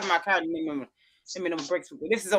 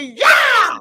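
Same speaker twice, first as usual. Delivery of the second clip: mock shouted yeah; 11 semitones higher, 11 dB louder than usual. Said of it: "She said".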